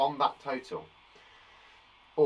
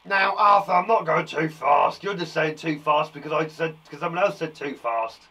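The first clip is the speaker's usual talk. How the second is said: mock protest; 4 dB louder than usual. Mock telling-off of a pupil.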